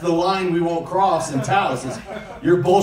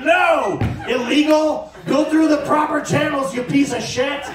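mock shout; 7 semitones higher than usual. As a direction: yell them back over the wall. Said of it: "kick 'em in the head and be like".

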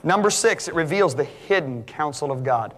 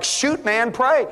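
eager; 9 semitones higher, much slower than usual. Retold or imitated. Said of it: "the sinners are like".

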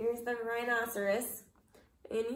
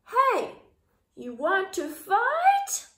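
high, loud, slow dare; bellowed challenge; loud shout; 8 semitones higher, 7 dB louder, much slower than usual. A narrator's reading. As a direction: shout up at the giant. Shouting at the whale.

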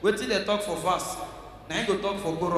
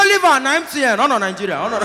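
mother's loud scolding; 3 semitones higher, 7 dB louder, faster than usual. Retold or imitated. Mother scolds sister loudly.

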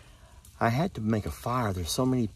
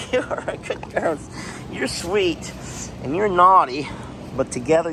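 doting on the dogs; 6 semitones higher than usual. Real, not acted. Praising the dogs.

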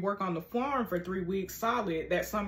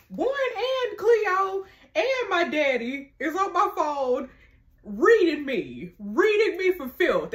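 outraged; 8 semitones higher, 6 dB louder, slower than usual. Real, not acted. Outraged at being scolded.

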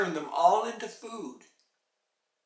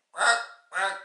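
two short imitated crow squawks; harsh little bird cries; 3 semitones higher, 6 dB louder than usual. A narrator's reading. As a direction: squawk a brief bird reply.

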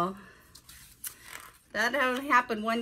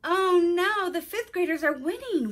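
high, louder cry of dismay; alarmed stage whisper; 9 semitones higher, 7 dB louder than usual. A narrator's reading. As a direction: read it as a worried child's exclamation, pitched right up. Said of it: "whispered Hannah".

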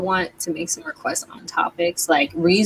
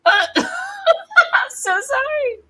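laughing; 13 semitones higher, 4 dB louder than usual. Real, not acted. Laughing at own rant.

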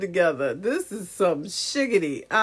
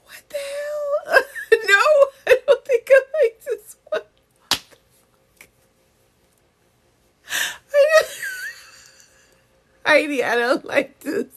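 high-pitched, helpless real laughter through the words; laughing; 5 dB louder than usual. Real, not acted. Telling Heidi she doesn't like this one, laughing. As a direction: squeal it out, voice breaking with laughter.

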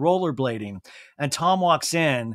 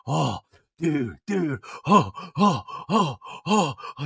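mock heavy huffing; imitating a man panting through cold shock; deep exhaling; slower than usual. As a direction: puff out short breaths between words. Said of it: "He's exhaling deeply".

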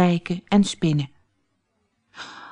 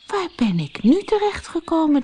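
higher, puzzled wondering; 10 semitones higher than usual. Acted. Floortje wonders where she has landed, amazed. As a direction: ask it in amazement. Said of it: "keek verbaasd om zich heen".

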